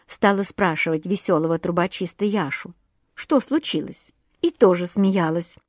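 plain reading voice

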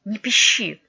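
put-on boy angrily silencing his friend; angry. A narrator's reading. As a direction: cut off the whining crossly. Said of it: "сердито сказал Никита".

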